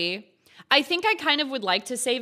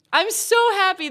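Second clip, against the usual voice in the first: high, louder burst of joy; overjoyed; 9 semitones higher, 5 dB louder than usual. Real, not acted. Streamer gushing to chat over fixed Xbox. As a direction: squeal it out with delight.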